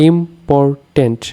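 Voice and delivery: ইম্পর্ট্যান্ট slowly divided into three beats; split into syllables. Shown as a demonstration. Teacher showing three syllables, ইম্পর্ট্যান্ট slowly split up. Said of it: "উচ্চারণ অনুযায়ী ভাগ করলে".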